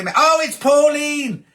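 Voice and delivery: high, drawn-out happy cry of recognition; delighted recognition. Real, not acted. Host spotting a birthday viewer in the chat, delighted.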